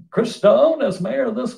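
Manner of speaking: hammed-up goofy Southern accent. Panelist imitating the mayor's attempt at a line, goofy Southern accent. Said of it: "that goofy Southern accent that he's trying to do".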